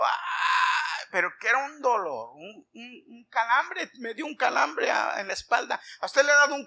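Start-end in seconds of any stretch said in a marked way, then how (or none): 0.0-1.0 high, drawn-out "aah"; high-pitched imitated pain scream. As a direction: re-enact a long cry of pain. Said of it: "yo estaba gritando, gritando".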